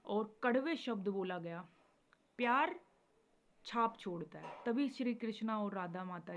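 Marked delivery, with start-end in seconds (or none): 2.4-2.8 प्यार hit higher and louder with a rise; stressed love. Shown as a demonstration. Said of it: "प्यार से बोला हुआ".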